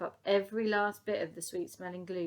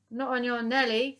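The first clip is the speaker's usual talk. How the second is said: loud, higher sharp retort; snapping; 5 semitones higher, 8 dB louder than usual. Acted. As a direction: snap back at him. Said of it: "snapped the lady".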